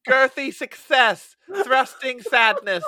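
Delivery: high, loud, slow verdict; announcer voice. Retold or imitated. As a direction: proclaim it like a headline. Mock review announcement.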